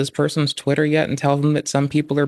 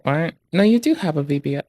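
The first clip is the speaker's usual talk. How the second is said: ordinary talk, nothing marked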